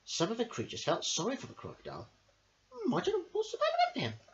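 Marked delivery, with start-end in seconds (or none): 2.7-4.2 high, fast little deer voice; puzzled deer, quick and high. Acted. Deer wondering about the crocodile, high and puzzled. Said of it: "asked the deer".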